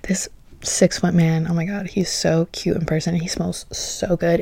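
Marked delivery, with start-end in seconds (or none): 1.5-4.4 soft dreamy whisper; whispered gush; swooning whisper. Real, not acted. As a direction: breathe it out, smitten. Swooning over the rapper.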